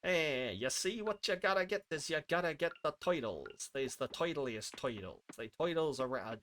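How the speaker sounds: put-on Boston-or-Chicago gangster accent; mock gangster accent. Streamer clowning about getting the toidles, mock gangster accent. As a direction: play a wiseguy with a rough-guess American accent. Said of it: "I'm gonna stop doing this voice".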